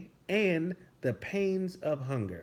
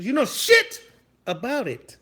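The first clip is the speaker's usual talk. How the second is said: high, loud emphatic jab; sudden scornful yell; indignant outburst; 5 semitones higher, 5 dB louder than usual. Pretend. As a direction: flare up in mock outrage. Rant flares up again.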